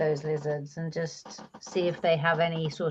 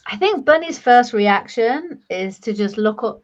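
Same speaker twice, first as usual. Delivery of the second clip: usual speaking voice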